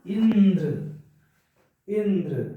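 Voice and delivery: Indra with weight on इ joined to the न; stress on first syllable. Shown as a demonstration. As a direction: lean on the opening "in" of Indra, then drop. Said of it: "ई पे बल दिया जा रहा है ना के साथ".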